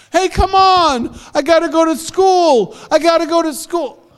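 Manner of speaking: put-on high, upset child; frustrated kid. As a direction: complain from the road. Boy after falling from bus.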